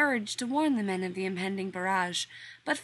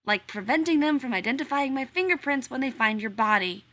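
louder, higher threat through the teeth; menacing threat; 5 semitones higher, 7 dB louder than usual. Acted. Rodney threatening the workman who flirts with John, louder. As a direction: warn him off, half joking.

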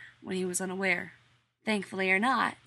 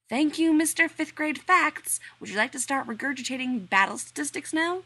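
loud sarcasm; 7 semitones higher, 6 dB louder than usual. Acted. Rodney mocking John's history facts, loud sarcasm. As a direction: sneer at his trivia.

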